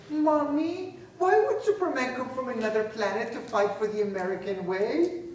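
a hint of an innocent child voice; child asking. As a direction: ask like a curious little kid. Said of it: "raise their heads and say".

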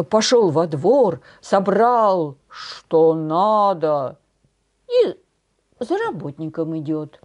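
usual speaking voice

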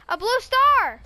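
thrilled shout at a find; excited. Real, not acted. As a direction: announce the discovery eagerly. Excited find, repeated louder.

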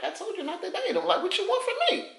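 high, laughing told-you-so; laughing exasperation. Pretend. Friend laughing at girl crying over cheater, amused. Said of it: "you looking at it like".

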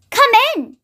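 high, lively call; crying out cheerily. A narrator's reading. Little Miss Fun welcoming a passer-by, calling out. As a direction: call out an invitation. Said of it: "she cried".

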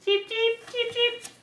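very high, short cheeps; little chick. Acted. Parent showing what little chicks say, high cheeping. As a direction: peep high like a baby chick. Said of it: "The little chicks say".

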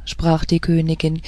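plain reading voice

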